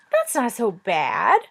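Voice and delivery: slow, drawn-out little dragon voice. Acted. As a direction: let the dragon stretch each word lazily. Dragon speaks from above.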